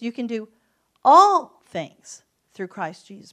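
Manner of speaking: build, then blast "all things through Christ Jesus"; preacher hammering "all"; stress on ALL THINGS. Shown as a demonstration. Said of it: "How about this?".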